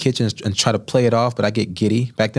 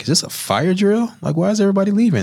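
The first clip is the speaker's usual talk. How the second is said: much higher, fast bewildered question; confused alarm; 11 semitones higher than usual. Retold or imitated. Young cook puzzled as the kitchen empties, high and confused. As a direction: sound baffled as everyone walks out. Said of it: "I'm like".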